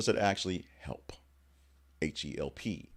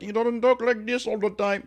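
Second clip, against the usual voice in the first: high, loud put-on Jamaican accent; hammed-up Jamaican stage voice, pitched way up; mock Jamaican accent; 8 dB louder than usual. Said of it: "his weird Jamaican sounding voice".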